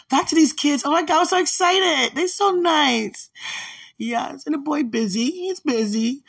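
high, loud thrilled squeal; excited. Real, not acted. Gushing over the kids' photos, excited squeal. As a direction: gush over them, bubbling.

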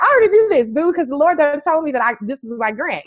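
high, loud, playful boast; excited sass. Retold or imitated. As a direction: crow it like you knew all along.

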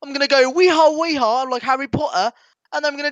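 high, hammed-up British voice; weird British accent. British wizard boarding a broomstick, silly high accent. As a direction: do a silly high-pitched Brit. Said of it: "weirdest British person ever".

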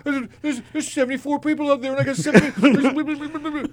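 high, stammering worry; acted-out panic. Comic retelling his nerves before a seven-minute set, high and panicky. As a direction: play a mildly panicked, high-pitched fret.

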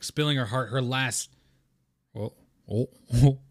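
usual speaking voice

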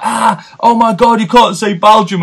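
high, slow mock disbelief; mocking listeners. Listeners mocking his Belgium, high and slow. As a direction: imitate shocked listeners laughing at him. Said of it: "literally people were like".

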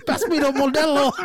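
put-on high, loud Mexican-accented request; hammed-up Mexican-accent holler; mock Mexican accent. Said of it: "super Mexican when he started talking to Mario Lopez".

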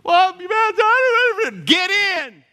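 high, loud, slow mock order; mock sergeant. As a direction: ham up a gruff command. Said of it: "Come on".

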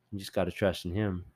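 ordinary talk

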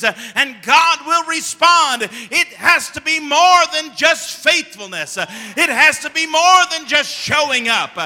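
fervent pulpit delivery; preaching. Acted. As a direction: build the sermon to a ringing climax. Pastor preaching to congregation.

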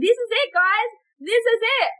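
high-pitched, thrilled declaration; retold burst of excitement; excited announcement. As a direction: announce the breakthrough with pitch leaping up. Retold moment of big realization.

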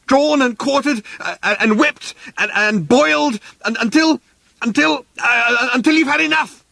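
very high, frantic furious rant; outraged ranting. Acted. Arthur threatening the council men over his house, high frantic rant. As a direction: splutter out threats you can barely finish. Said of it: "he yelled".

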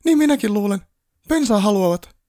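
very high, loud squeal of panic; panicked elf shriek. A narrator's reading. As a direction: shriek it like a frantic little elf. Panicking elf speaks again.

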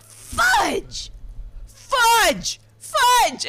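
high, loud, drawn-out shouts of fudge; imitated screaming curse. Mom re-enacts yelling over spilled sprinkles, loud high shouts. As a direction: yell it out like a volcano erupting. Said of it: "do an imitation of how it was, but just say fudge".

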